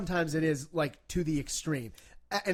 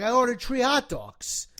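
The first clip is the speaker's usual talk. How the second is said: higher, slow Rhode Island "tree hot dogs"; Rhode Island accent; 7 semitones higher, slower than usual. Retold or imitated. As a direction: imitate a Rhode Island local dropping the th. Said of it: "They say tree".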